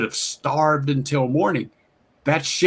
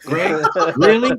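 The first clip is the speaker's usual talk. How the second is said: incredulous, rising higher and louder on the last Really; mock disbelief; 5 semitones higher, 4 dB louder than usual. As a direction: act out mock outrage at Greg. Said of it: "I said".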